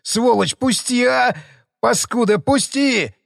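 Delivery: high, desperate squeal; shrieking. Acted. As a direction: squeal to be let go. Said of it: "верещал мародёр".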